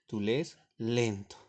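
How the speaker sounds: drawn out slowly, each word weighted; slow demo. Shown as a demonstration. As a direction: say it slowly to show lento. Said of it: "Miren cómo varía la significación". (lento, pronunciado despacio)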